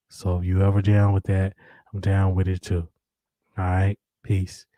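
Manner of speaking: late-night host voice inviting Sherri out to dinner. Pretend. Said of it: "put on my late night voice".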